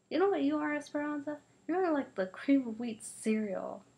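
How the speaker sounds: high, loud schoolyard taunt. Acted. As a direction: needle her with a sneer. Kids trading insults.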